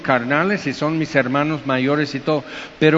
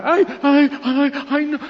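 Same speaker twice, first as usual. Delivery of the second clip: high whiny fake complaining; mock whining; 10 semitones higher than usual. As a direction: whimper like a crybaby being picked on. Mocking adults whining about bullying. (quejido burlón muy agudo)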